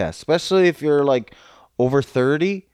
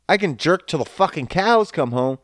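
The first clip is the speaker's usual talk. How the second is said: ordinary talk, nothing marked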